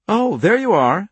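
put-on bright smiling young woman; smiling girl voice. A narrator's reading. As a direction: greet him with a smile. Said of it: "she said, smiling".

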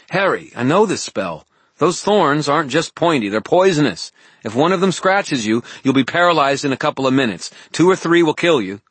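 high, loud, urgent woman's warning; Elaine warning urgently. Acted. Voices Elaine warning Harry.

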